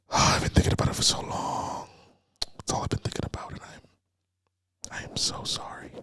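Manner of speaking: whispered mock confession; mock creepy whisper. Mocking a creepy guy whispering his apology down the phone. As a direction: hush it like a creepy secret. Mock late-night voice message.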